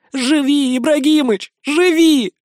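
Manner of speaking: high, pleading cry; begging him to live, voice rising; desperate plea. A narrator's reading. Boy pleads over dying master. (отчаянная мольба, голос срывается вверх)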